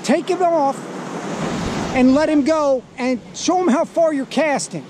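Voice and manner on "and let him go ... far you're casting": normal talking voice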